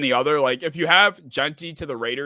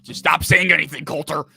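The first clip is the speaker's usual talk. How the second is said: raised voice cutting someone off; louder, higher, fed-up demand to stop; exasperated outburst; 4 semitones higher, 6 dB louder than usual. Real, not acted. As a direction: tell them off sharply, suddenly louder. Snaps at Coulter after muttering.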